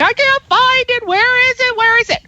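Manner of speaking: high-pitched whiny mimicry; mock helpless listeners. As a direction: imitate flustered people who can't find something. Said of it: "People are like".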